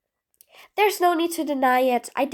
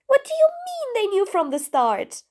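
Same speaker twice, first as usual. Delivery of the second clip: high-pitched disbelieving demand; incredulous; 7 semitones higher than usual. Acted. Villain hears the prisoner's team knew all along, incredulous. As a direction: pitch jumps up in disbelief, then falls.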